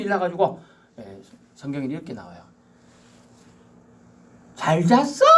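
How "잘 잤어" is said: high "잘 잤어?" with a loaded tone; pointed greeting. Shown as a demonstration. Preacher demonstrating a greeting with an edge. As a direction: greet with hidden meaning. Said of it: "뉘앙스를 들어야 돼".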